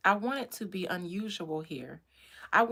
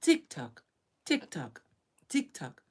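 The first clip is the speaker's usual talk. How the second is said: even machine gun syllables; glitching robot; 6 semitones lower than usual. Retold or imitated. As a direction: repeat each word like a stuck machine. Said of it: "Gen Zs and Gen Alphas glitching".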